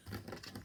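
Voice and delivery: airless wheeze of laughter; a soundless laugh burst; breathless wheezing laugh; silent breathy laugh. Real, not acted. Cracking up at story.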